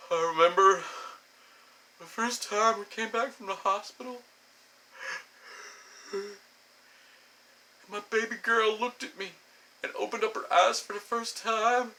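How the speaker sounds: high, choked-up mock-emotional voice; sentimental parent. Parent recalling the happiest moment, mock-tearful and high. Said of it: "you get that guy, and he's like".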